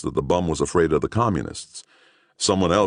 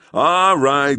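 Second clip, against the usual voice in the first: high, louder call to the crowd; policeman's call; 11 semitones higher, 6 dB louder than usual. Acted. Policeman calling out to a gawking crowd. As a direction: raise it to cut through the crowd.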